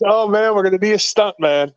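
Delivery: lightly voiced old wrestler giving up on the rookie; mock dismay. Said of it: "And he goes".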